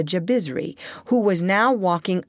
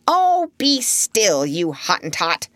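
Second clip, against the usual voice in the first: snapped; slower than usual. A narrator's reading. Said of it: "snapped Polynesia".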